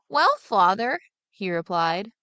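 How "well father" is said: put-on higher, lightly puzzled boy's voice; boy mocking what others always say, higher and puzzled; simple younger son. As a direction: play the naive lad wondering aloud.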